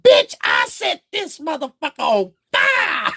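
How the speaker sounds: high, slow shouted threat; the wig losing its temper, yelling; high cartoon shout; angry wig voice. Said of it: "got his own voice".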